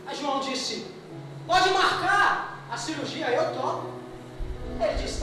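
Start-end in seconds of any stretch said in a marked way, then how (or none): 1.5-3.9 higher-pitched, slow, decisive reply; bold acceptance. Acted. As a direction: declare it boldly, stretching the words. Patient boldly agrees to surgery.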